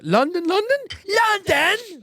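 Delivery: put-on high, slow English lad accent. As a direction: say it like a Pokemon cry. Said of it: "He's like".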